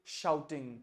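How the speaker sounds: "shouting" said with a clear sh sound at the start; careful pronunciation. Shown as a demonstration. Teacher modelling "shouting" for a student, with a clear sh. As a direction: model "shouting" slowly with a full sh. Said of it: "अच्छे से इसका उच्चारण कीजिए".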